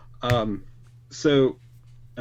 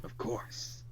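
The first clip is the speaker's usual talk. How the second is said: whispered; 13 dB quieter than usual. Acted. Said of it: "dismayed repeat of of course".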